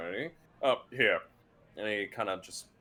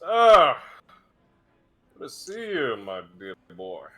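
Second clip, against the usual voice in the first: hearty greeting; 2 semitones higher, 14 dB louder than usual. Acted. Samson booming a welcome to his young guest. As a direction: throw the arms open in welcome.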